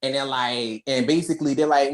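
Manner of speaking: usual speaking voice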